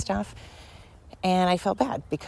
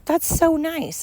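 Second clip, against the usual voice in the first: higher, sweet "aw, so nice"; touched aw; 10 semitones higher, 3 dB louder, much slower than usual. Retold or imitated. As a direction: coo it like it melts your heart. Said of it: "And I was like".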